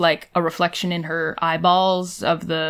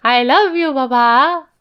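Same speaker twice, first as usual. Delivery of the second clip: put-on high, slow sugary little-boy voice; wheedling son; 6 semitones higher, 3 dB louder, slower than usual. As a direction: coo it like a child sucking up. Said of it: "let's reenact the phone call".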